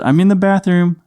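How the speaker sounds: put-on high-pitched call to a child; dad calling down. Dad upstairs explaining to his son, high calling voice. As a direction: call it out to a little one downstairs.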